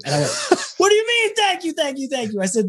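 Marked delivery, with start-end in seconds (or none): 0.8-2.4 much higher, incredulous retelling; retold disbelief. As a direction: replay the shock of being thanked by a legend. Disbelief at legend thanking him.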